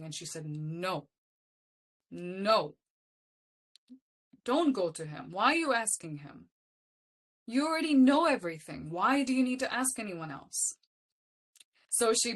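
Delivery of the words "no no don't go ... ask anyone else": firm retold scolding. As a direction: forbid it firmly, as a teacher would. Retold teacher forbidding her questions.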